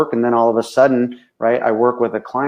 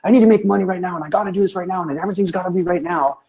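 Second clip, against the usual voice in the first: fast, higher, breathless panic; frantic rush; 8 semitones higher, faster than usual. Retold or imitated. Reactionary business owner demanding money now, rushed and frantic. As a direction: rattle it off like a frantic client. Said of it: "we act reactionary".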